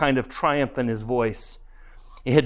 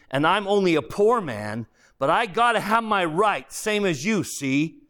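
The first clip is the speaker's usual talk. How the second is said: higher-pitched, insistent ghost with a grudge; aggrieved ghost; 6 semitones higher than usual. Acted. A ghost defends himself to his former employee, aggrieved. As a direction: argue like a wronged common man.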